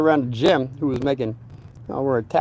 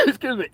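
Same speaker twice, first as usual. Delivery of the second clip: real cough; 11 semitones higher, 3 dB quieter, faster than usual. Real, not acted. Coughing mid-story.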